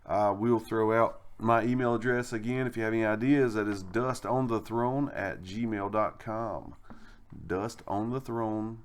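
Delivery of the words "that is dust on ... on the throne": slow and deliberate. Real, not acted. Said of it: "when you said that like that".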